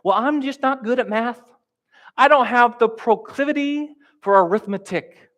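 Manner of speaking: high, whining excuse; hammed-up whiny child; whiny kid excuse. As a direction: play a kid wriggling out of a bad grade. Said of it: "they say something to you like".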